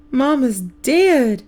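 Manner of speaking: high, slow, loud wail; a small boy bawling for his mother. A narrator's reading. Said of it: "Buddy wails".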